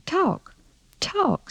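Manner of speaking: very high falling call. Acted. Mama repeating herself to upstairs, calling up. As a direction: pitch it way up, one word at a time.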